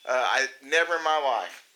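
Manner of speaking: ordinary talk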